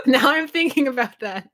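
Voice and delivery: high voice breaking with laughter; laughing. Real, not acted. Speaker can't stop thinking about the name, laughing. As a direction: talk through the giggles. Cracking up over the name debate.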